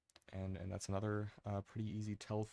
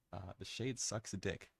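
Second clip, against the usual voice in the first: ordinary delivery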